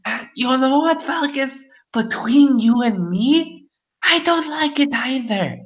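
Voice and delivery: high, confiding crone voice; fortune teller. Acted. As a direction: whisper the gossip like an old hag. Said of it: "she laughs when she hears this and like".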